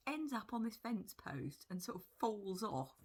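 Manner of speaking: normal talking voice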